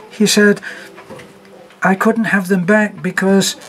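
hysterical. A narrator's reading. Hysterical laughter, shaken by shoulders.

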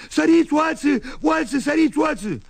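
mock frantic shout, pitch jumping high; retold cry for help. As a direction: holler like a scared man running for his life. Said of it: "începu să strige".

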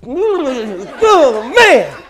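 high, loud drawn-out alarm, played for laughs; freaked out. Officer reacts to the possessed man, hammed-up freaked-out cry. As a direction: wail it in comic panic. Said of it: "Freaked me out".

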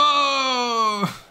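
high-pitched burst of laughter. Real, not acted. Laugh after hype.

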